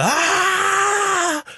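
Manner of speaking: long, high mock wail; high-pitched held "aah" of despair, hammed up; imitated wail. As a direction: imitate a sobbing runner's drawn-out cry. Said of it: "giving it the".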